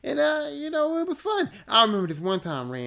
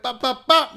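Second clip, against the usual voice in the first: loud, higher mouth gunshot pops; 9 semitones higher, 9 dB louder than usual. Retold or imitated. Comedian mimicking his sideways gun, loud pops. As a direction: spit out pop pop pop like shots.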